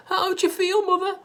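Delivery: high, anxious cry. A narrator's reading. Missus Cranky anxiously asking her tiny mother, high. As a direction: call out worried. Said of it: "cried Missus Cranky anxiously".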